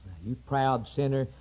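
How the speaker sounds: usual speaking voice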